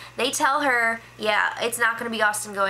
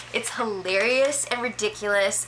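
normal talking voice

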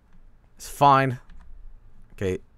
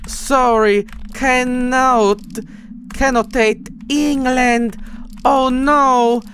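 very high, much louder falsetto, dragged out very slowly; bastard voice again; 7 dB louder, much slower than usual. Pretend. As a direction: stretch each word in a mock broken-English squeak. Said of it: "The bastard voice".

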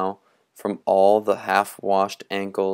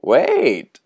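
shouting; 4 semitones higher, 7 dB louder than usual. Acted. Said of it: "he shouted".

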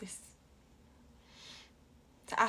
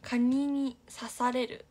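slow read; 5 dB louder, much slower than usual. Retold or imitated. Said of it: "reading comment slowly".

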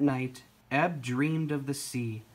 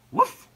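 short, loud imitated bark; dog woof; 9 dB louder than usual. A narrator's reading. Ebb wants someone to play with, woofing. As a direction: give a quick doggy woof. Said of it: "said Ebb".

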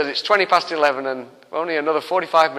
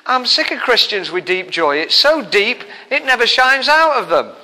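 usual speaking voice

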